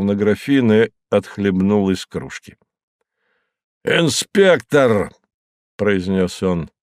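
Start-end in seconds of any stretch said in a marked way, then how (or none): 3.8-5.1 commanding call. A narrator's reading. Moses calls inspector.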